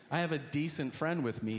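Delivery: ordinary talk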